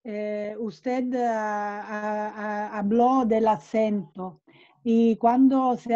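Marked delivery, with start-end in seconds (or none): none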